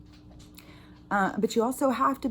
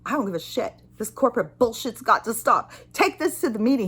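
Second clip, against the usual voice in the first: retold rant, building louder and higher; angry outburst; 3 semitones higher, 4 dB louder than usual. Caller losing it at corporate phone agent, angrily re-enacted. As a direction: build into an indignant tirade.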